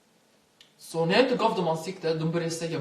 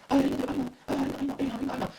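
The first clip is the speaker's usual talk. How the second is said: much higher, taunting imitation of the guys; mimicking the guys mocking him for calling Aina, high and taunting; mocking the others; 9 semitones higher, much faster than usual. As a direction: parrot them in a high, sneering singsong. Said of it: "De bara".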